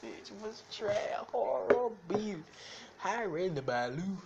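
words squeezed out while cracking up; laughing while talking. Real, not acted. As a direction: push through the giggles. Laughing hard at himself.

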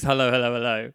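a policeman's hello dropping away; bobby greeting, fading. Shown as a demonstration. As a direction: demo of the bobby's opening line. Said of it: "the first line they have to be is".

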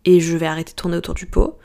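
usual speaking voice

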